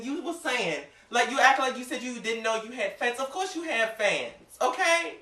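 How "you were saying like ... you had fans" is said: normal talking voice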